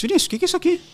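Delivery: high startled cry of disbelief; shocked. Retold or imitated. Brother sees scarred ear.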